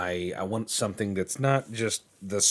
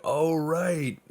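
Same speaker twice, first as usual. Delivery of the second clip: dawning realization; 10 semitones higher, 5 dB louder than usual. Retold or imitated. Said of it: "they're like".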